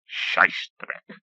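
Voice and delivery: muttered under the breath, unvoiced; mumbled. A narrator's reading. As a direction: mutter it darkly to yourself. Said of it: "the professor mumbled".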